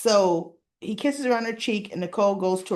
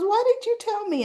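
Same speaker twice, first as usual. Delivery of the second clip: high-pitched mock outcry; acted nosy demand; 14 semitones higher, 3 dB louder than usual. Coworker pressing her friend about the elevator, nosy demand. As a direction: playfully demand an explanation, pitch way up.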